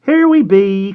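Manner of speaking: very high, loud announcing call; hearty cry. A narrator's reading. Missus Penhallow arriving with a tray of food, crying out. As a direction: sing out as you arrive. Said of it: "cried Missus Penhallow".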